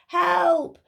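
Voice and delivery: high plaintive call for help; mournful cry. Acted. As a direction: call out sadly from underneath. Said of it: "came a mournful voice".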